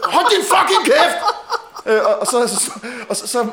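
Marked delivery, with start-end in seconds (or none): 0.0-1.2 much higher, louder, slow mock outburst; retold furious yell. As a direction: spit out each word, laughing through it. Acted quarrel, telling colleague off.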